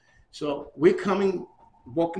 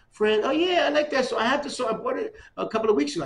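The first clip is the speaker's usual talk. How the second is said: much higher, louder gushing; star-struck fan; 8 semitones higher, 5 dB louder than usual. Retold or imitated. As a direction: gush like a starstruck kid.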